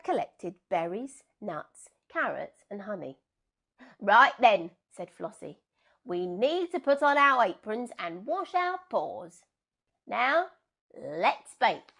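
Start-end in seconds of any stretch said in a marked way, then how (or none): 4.0-11.9 loud, brisk sheep in charge; rally the flock to bake; bossy sheep Flossie. Acted. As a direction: sheep ordering everyone to wash their paws.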